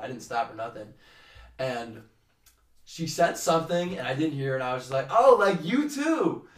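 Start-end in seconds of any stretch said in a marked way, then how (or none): 5.1-6.5 laughing reply. Retold or imitated. Awkward reply to girl.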